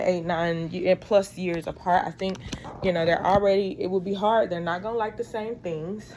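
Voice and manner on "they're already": usual speaking voice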